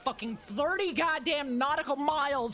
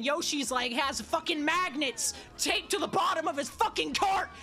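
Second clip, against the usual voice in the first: angry rant; 6 semitones higher than usual. Real, not acted. Ranting at Yoshi in race.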